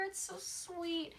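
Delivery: slow, high and soft, melting; gushing. Real, not acted. Gushing to viewers about the couple's love, slow soft awe. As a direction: sigh it out fondly.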